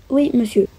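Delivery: normal talking voice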